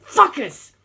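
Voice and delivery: loud, high furious curse; angry yell. A narrator's reading. Navigator cursing the enemy planes, angry yell. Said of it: "Jim yelled".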